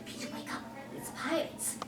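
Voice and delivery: whispered warning to a sleeper; fairy whispering pirates near; urgent whisper. Acted. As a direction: hiss it urgently in his ear. Warning sleeping Peter quietly.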